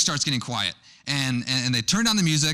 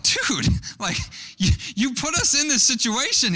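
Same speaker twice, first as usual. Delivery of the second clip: higher and louder, laughing in disbelief; exasperated laugh; 12 semitones higher, 3 dB louder than usual. Retold or imitated. Family scolding the brother who caused it, laughing and exasperated. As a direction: call him out through a laugh.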